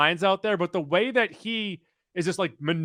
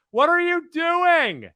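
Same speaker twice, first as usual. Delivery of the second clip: incredulous shout; 12 semitones higher, 4 dB louder, slower than usual. Retold or imitated. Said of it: "quoting sketch line".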